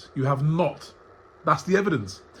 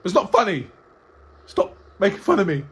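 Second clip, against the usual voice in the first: higher-pitched parody of a touchy guest; mock wounded complaint; 5 semitones higher, 3 dB louder than usual. Host apes the guest objecting to being clowned, mock hurt. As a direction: whinge in mock offence, pitched up.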